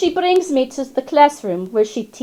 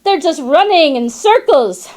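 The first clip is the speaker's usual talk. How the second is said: ordinary delivery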